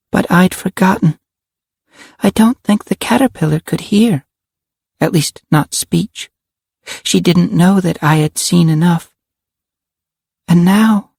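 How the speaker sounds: put-on high little-girl voice; a child's high voice, recounting gravely; little girl Karen, solemn. Karen tells of Cassie's sacrifice, solemnly.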